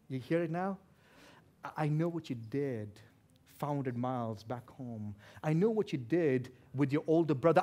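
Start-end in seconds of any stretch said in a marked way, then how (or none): 1.6-7.6 low, measured insinuation; knowing accusation. Acted. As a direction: let him know the secret is out. Acts Laban's veiled threat to Jacob.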